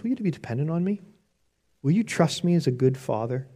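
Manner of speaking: tender fatherly plea. Retold or imitated. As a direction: coax gently like a good father. God's tender invitation.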